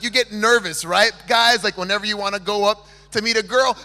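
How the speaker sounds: ordinary talk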